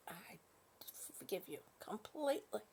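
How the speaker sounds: breathy hushed whisper of forgiveness. Pretend. Whispering forgiveness to a chat viewer Sam. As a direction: lean in and whisper it.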